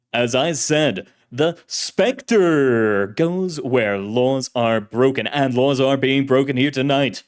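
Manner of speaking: booming, grandiose self-introduction with a long drawn-out final name; old-time radio hero. Acted. Shadowy hero announces himself to the officer, booming radio-serial voice. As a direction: proclaim it like a masked serial hero. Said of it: "voice was like an actor in an old-fashioned serial".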